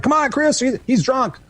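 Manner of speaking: high, silly partygoer voices; mock friends at Bernie's. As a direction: play the goofy pals pretending he's drunk. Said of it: "like a weekend at Bernie's".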